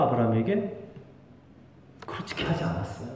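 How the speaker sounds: ordinary delivery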